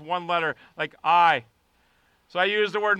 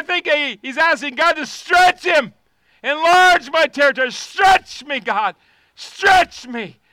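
shouted, rising prayer at full volume; fiery pulpit prayer; preaching at full force; 9 semitones higher, 10 dB louder than usual. Acted. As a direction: cry out to God to stretch you.

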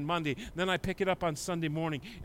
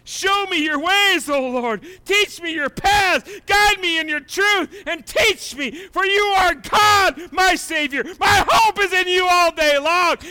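high, loud, slow shouted prayer; bold psalm cry; 12 semitones higher, 11 dB louder than usual. Acted. Psalmist boldly calling to God, shouting. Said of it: "Look how bold he gets".

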